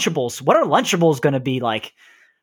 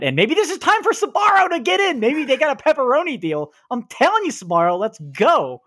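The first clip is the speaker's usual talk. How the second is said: high, eager hype; excited; 11 semitones higher than usual. Real, not acted. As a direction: get hyped about Sbarro. Excited pitch for Sbarro.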